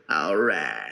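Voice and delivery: very low, drawn-out movie-star drawl; Matthew McConaughey. Retold or imitated. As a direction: drop deep for a star's catchphrase. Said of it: "I hope his accent's the same".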